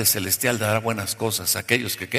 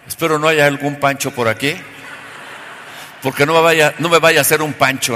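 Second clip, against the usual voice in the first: much louder, playful jab at the crowd; loud joking aside; 2 semitones higher, 7 dB louder than usual. Real, not acted. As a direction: tease the audience loudly. (broma en voz muy alta)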